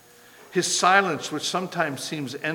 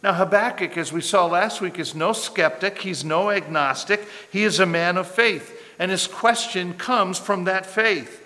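normal talking voice

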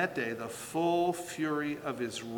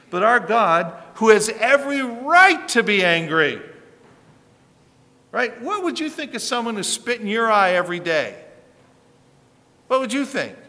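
rising, louder preaching build; fervent preaching; 7 semitones higher, 10 dB louder than usual. Acted. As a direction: drive the point home to the congregation. Loud preaching challenge.

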